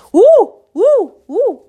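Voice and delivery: high, loud "woo" calls; mock whooping. Mocking how men sound, high whoops. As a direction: whoop in short high bursts.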